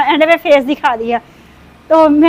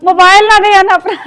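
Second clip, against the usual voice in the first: raised, firm warning about the phone; a warning said louder before the laugh; 4 semitones higher, 4 dB louder than usual. Real, not acted. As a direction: call it out like a firm reminder.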